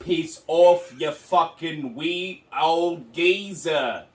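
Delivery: mock British insult. Co-host insulting his mum, slow mock British accent. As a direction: drag out the British put-down. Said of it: "mock British mum quarrel".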